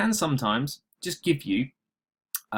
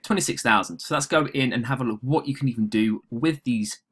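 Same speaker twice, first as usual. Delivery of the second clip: usual speaking voice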